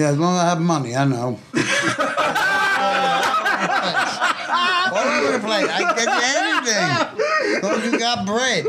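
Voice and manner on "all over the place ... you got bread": high, slow words through laughter; laughing. Real, not acted. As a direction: laugh while you talk. Laughing through his own joke.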